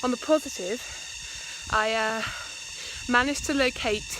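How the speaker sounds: panting from walking uphill; breathless after a climb; out of breath. Real, not acted. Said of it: "I'm out of breath".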